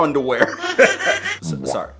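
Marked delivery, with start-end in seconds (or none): 0.6-1.4 high squeaky giggle. Real, not acted. After joking to viewers about underwear, high giggle. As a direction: laugh at your own joke. Laughing at his own joke.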